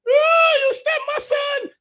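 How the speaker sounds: very high, drawn-out imitated wail; screaming mother. Mother finding her son jabbed, mock high scream. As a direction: screech it as an outraged mother. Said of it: "And the mother started to".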